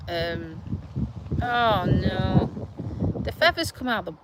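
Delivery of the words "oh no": normal talking voice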